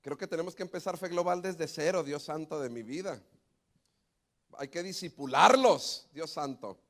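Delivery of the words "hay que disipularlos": emphatic proclamation. Real, not acted. Joking exclamation to congregation.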